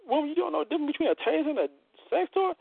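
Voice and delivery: high fast disbelieving rant; mock-scolding the woman in a high, fast voice; incredulous. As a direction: shoot up high and rattle it off in disbelief. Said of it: "I'm like".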